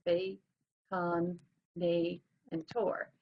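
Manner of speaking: "bacon, nay, and tor" broken into separate beats; syllable by syllable. Shown as a demonstration. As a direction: slowly sound out each piece of "bacon, nay, and tor". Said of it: "baconator is actually four syllables".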